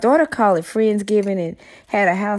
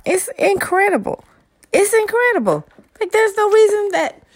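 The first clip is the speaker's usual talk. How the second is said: much higher, emphatic amazement; real excitement; 11 semitones higher, 4 dB louder than usual. Real, not acted. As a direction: marvel out loud, high-pitched and thrilled. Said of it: "I'm just excited about".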